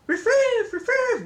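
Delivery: high, silly "riff-riff" barks; mock Mexican dog bark. Grandma joking how Mexican dogs don't go, mock barking. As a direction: yip an exaggerated foreign-sounding bark. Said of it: "dogs in Mexico don't go".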